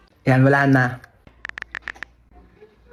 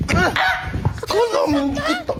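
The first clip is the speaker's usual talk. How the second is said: mock fight yell; 10 semitones higher, 3 dB quieter, much slower than usual. Siblings fighting.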